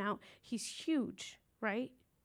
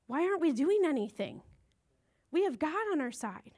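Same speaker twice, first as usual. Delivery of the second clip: higher, louder, indignant; rally the scared soldiers, why are we waiting; eager outburst; 5 semitones higher, 4 dB louder than usual. Retold or imitated. Young David to the frightened army, indignant and eager.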